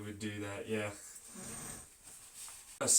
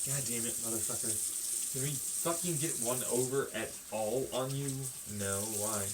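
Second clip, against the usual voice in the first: angry father voice; 5 dB quieter than usual. Pretend. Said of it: "I'm gonna pretend to be your father".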